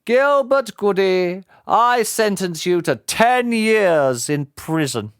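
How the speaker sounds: high, slow formal sentence; solemn judge. Acted. Judge sentencing Gilbert Goody, slow and solemn. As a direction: deliver the sentence with weight.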